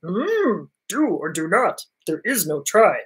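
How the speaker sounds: a hint of an old master's verdict; Yoda impersonation. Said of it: "going to do my Yoda impersonation".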